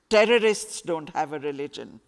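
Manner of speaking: plain reading voice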